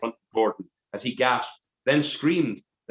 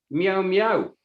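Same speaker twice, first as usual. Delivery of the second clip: high, loud mewing like a kitten; high kitten meow; 9 semitones higher, 4 dB louder than usual. Acted. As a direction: crone mews to lure Gordon, high pitched. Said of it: "she gestured".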